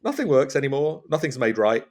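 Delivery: normal talking voice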